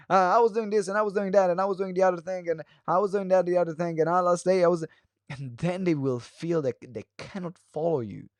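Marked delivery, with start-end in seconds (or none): 0.4-4.8 rapid-fire run-on rambling; a thousand miles an hour. Shown as a demonstration. Storyteller racing through the story for the audience, very fast. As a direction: rattle it off without a break, slightly higher. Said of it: "a thousand miles an hour".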